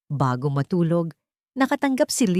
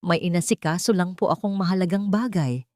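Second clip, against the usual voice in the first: plain reading voice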